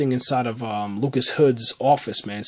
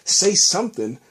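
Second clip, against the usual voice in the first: mock yell; 5 semitones higher than usual. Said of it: "I, I scream".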